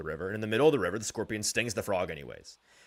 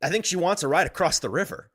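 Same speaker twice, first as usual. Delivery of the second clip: normal talking voice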